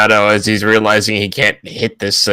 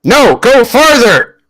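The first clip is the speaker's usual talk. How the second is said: high, drawn-out urgent shout; urgent yelling; 13 semitones higher, 4 dB louder, slower than usual. Acted. Grabbed character sends thirteen-year-old away, urgent shout. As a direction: shout them further away. Captured character yells child onward.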